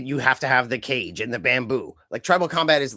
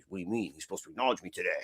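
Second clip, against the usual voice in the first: much lower and softer, a hushed indignant Roman; low quiet Roman impression; 9 semitones lower, 10 dB quieter, faster than usual. Roman demanding Jacob acknowledge him, in a low hushed voice. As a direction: mutter it like an aggrieved chief, low. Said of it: "Roman is being like".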